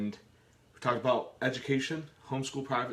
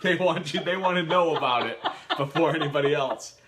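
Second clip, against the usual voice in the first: talking through real laughter, higher and louder; laughing hard; 6 semitones higher, 7 dB louder than usual. Real, not acted. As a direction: push the words out while cracking up. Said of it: "You got tears in my eyes".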